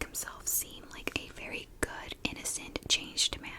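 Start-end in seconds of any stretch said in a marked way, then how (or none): none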